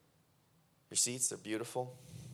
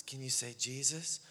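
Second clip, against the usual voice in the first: put-on soft, patient questions to the deaf girl; gentle coaxing; 2 semitones lower, 10 dB quieter than usual. Pastor gently coaxing a healed deaf girl to speak. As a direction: coax her gently, waiting after each. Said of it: "Then I said".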